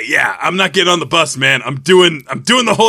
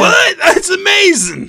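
squeaky high voice; 12 semitones higher, slower than usual. Acted. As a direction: voice cracking way up high. Character voice jumps high.

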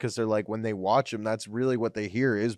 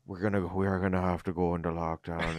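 lower, put-on regretful announcement; mock sad; 5 semitones lower, 4 dB quieter than usual. Guest imitating premier announcing lockdown, lower mock-sad voice. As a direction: feign sorrow while delivering bad news. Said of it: "he'd act like he was so sad".

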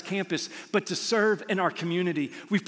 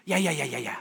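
dismissive waiter; faster than usual. Acted. Waiter brushing off the customer.

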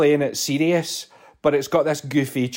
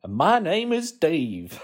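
slow mock intro; 3 semitones higher, much slower than usual.

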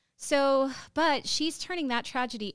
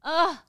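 emotional groan; 6 semitones higher, 9 dB louder than usual. Real, not acted. Overwhelmed by emotion.

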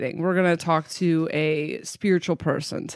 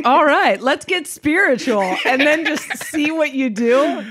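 higher, louder hyped announcement; excited catchphrase; 8 semitones higher, 4 dB louder than usual. Retold or imitated. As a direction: sell it like a big reveal. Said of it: "I was gonna be like".

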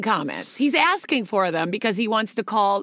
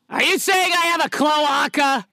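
lightly voiced puppet asking in disbelief; sock puppet role; 4 semitones higher than usual. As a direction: ask it higher, as a put-on puppet question. Acting out the sock puppet's line.

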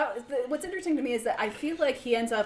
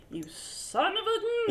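mock movie villain; 11 semitones higher, much slower than usual. Quoting film line, high odd voice.

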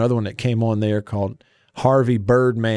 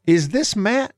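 puzzled listener voice, pitched up; confused listener; 9 semitones higher than usual. Retold or imitated. Listener baffled by the odd show, asking mock-puzzled. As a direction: play a baffled radio listener. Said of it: "You're like, first of all".

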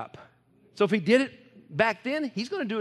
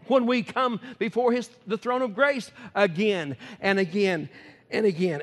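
normal talking voice

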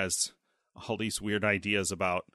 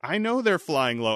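higher-pitched, halting, pleading act; flustered president on phone; 7 semitones higher than usual. Retold or imitated. President phoning Alexei about planes, mock flustered. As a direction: act out an exasperated, stumbling phone call.

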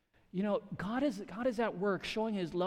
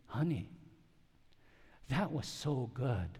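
soft, lower, slow tender quote; wife's praise; 3 semitones lower, 6 dB quieter than usual. Retold or imitated. Wife praising the preacher after service, tender and soft. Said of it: "she said to me".

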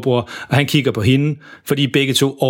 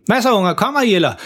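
higher-pitched mild call; 8 semitones higher than usual. A narrator's reading. Dad calls back to his kids, mildly calling out. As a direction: call back cheerily without really shouting. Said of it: "så råber han tilbage til dem".

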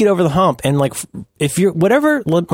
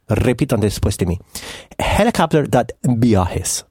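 put-on Spanish teacher accent; 4 semitones lower than usual. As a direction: play a Spanish teacher modelling the phrase. Mock Spanish teacher says repeat after me.

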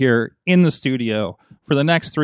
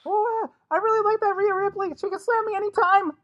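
put-on much higher, stammering nervous teen voice; Morty voice; 5 dB quieter than usual. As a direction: stutter it out in a jittery high pitch. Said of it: "your Morty voice sucks".

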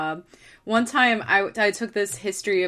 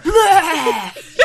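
mock surfer blurt; 10 semitones higher, 8 dB louder than usual. Mimicking surfer video.

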